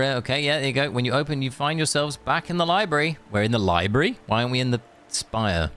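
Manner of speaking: ordinary talk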